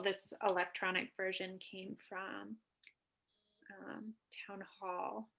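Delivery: hushed aside under the breath; whispering. Real, not acted. Aside during meeting discussion.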